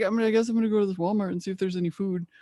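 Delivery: fast, light voicing of a lost dog; dog impression. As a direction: lightly voice the hungry dog wandering in. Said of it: "That's my dog impression".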